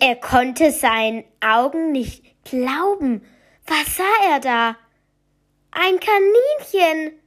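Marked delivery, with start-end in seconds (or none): 5.7-7.1 high, loud, slow excited shout. Acted. The boy finds a rabbit under his bed and shouts high and loud. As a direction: cry it out in amazement. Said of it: "schrie er".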